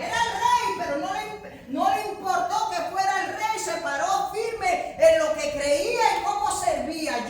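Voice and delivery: loud, high preacher's cry; impassioned sermon delivery; fiery preaching. Real, not acted. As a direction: preach it at full force to the congregation. Preaching at full force.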